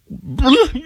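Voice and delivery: short, high blubbering blast; audible crying burst. Shown as a demonstration. Host explaining audible crying, sudden blubbering burst. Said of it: "But when I audibly".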